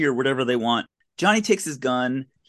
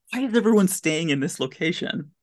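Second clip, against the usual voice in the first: ordinary delivery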